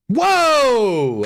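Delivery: high, loud startled whoa; surprised whoa. Real, not acted. Streamer startled by a friend's flag, loud whoa. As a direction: jolt up with real surprise.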